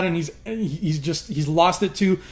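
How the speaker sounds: ordinary talk, nothing marked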